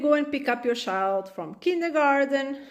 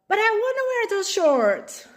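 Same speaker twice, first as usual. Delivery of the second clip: put-on high whiny little kid voice; a kid pleading for shorts, whiny and high; whiny child; 9 semitones higher, 3 dB louder than usual. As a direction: whine it like a stubborn toddler.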